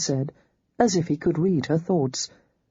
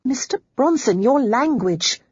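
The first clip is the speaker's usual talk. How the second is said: reproving; 8 semitones higher, 3 dB louder than usual. A narrator's reading. Holly scolds Bronson's rough language, reproving. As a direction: chide him for swearing. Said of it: "Holly reproved".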